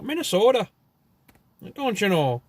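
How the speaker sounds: high, exaggerated Minnesota accent; hammed-up Minnesota sing-song. Shown as a demonstration. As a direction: pitch it up in mock Minnesotan. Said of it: "That's more like Minnesota".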